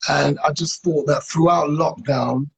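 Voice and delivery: loud, upbeat live-host pitch, high and bright; loud host intro. Acted. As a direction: sell the guest to the live audience with full energy. Host introduces guest loudly.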